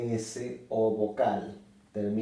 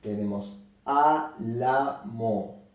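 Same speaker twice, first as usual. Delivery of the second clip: ordinary delivery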